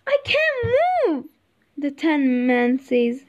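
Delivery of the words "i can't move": very high, slow exclamation; high-pitched storybook Tin Man discovering movement; freed Tin Man. A narrator's reading. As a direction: marvel in a high, drawn-out voice. Oiled Tin Man finds he moves.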